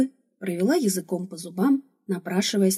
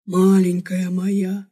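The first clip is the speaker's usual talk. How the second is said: slow, doting barbarian voice; blissful barbarian; 4 dB louder, much slower than usual. A narrator's reading. Barbarian dotes on the girl he feeds, blissful and slow. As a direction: draw it out with blissful relish. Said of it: "блаженствовал варвар".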